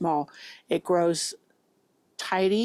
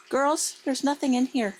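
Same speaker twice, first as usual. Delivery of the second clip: calling out; 7 semitones higher than usual. Real, not acted. Calling out to her animals.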